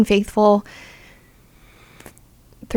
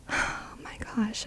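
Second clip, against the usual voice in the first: breathless whisper; 16 dB quieter than usual. Retold or imitated. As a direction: whisper it, overwhelmed with relief. Overwhelmed relief after dad's words.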